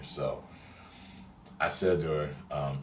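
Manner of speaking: normal talking voice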